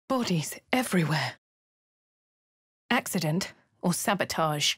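whisper. Acted. Hushed at a grim scene.

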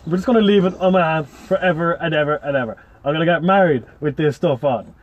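ordinary talk

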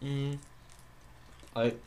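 chewing while talking; speaking between bites; mouth full. Real, not acted. Seen 0: Left glasses-wearer bites waffle at 0.2, chews while talking until ~2.4.

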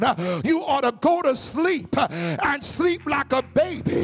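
rhythmic whooping sermon, each phrase hammered out over the organ; preaching at full force. Acted. Preacher urging the congregation to sleep and trust God, full whooping sermon. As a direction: rise into a sung preacher's cadence and drive it home.

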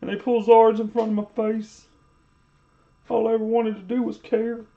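high, whiny mock voice; high whiny imitation of a streamer complaining. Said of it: "He's like".

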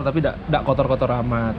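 ordinary talk, nothing marked

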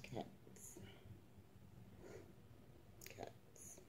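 hushed calling, barely voiced; soft whispered call. Real, not acted. Owner calling her cats in a whisper. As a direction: whisper the cats' names to call them.

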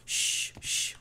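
hushed whisper, then shushing; librarian shushing whisper. Pretend. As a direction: whisper so the shark won't notice. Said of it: "Faça um silêncio".

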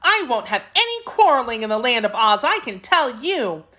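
put-on high-pitched girl laying down the law; stern. A narrator's reading. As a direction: scold them firmly in a high voice. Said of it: "said Dorothy sternly".

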